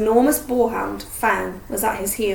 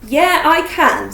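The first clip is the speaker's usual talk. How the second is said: loud, slow, higher insistence; defiant; 5 semitones higher, 7 dB louder, slower than usual. A narrator's reading. Harry defying Hermione, loud and determined. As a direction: insist firmly.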